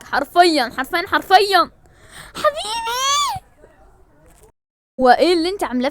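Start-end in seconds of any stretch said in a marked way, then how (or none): 2.3-3.4 very high, slow stretched حبيبي; hammed-up falsetto darling. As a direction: squeal حبيبي in a mock falsetto. Exaggerated endearment. (حبيبي بصوت رفيع مبالغ)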